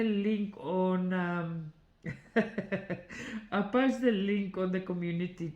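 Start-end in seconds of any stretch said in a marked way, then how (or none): 2.0-3.5 airy little giggle; breathy giggle. Real, not acted. Giggling mid-sentence.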